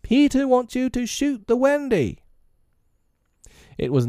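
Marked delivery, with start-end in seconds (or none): 0.0-2.2 shrill, high-pitched fairy voice; tiny fairy giving an order; Tinker Bell. A narrator's reading. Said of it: "came the shrill voice of Tinker Bell".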